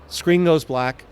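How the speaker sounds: ordinary delivery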